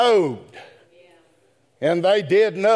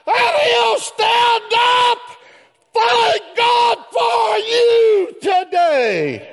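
high, shouted gospel preaching; slow-building, high-pitched revival shout; full-force preaching; slower than usual. Real, not acted. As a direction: belt it out to the whole church.

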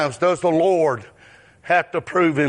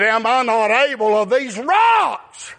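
preaching at full force; 11 semitones higher than usual. Acted. Preacher voicing God's words to the congregation, shouting.

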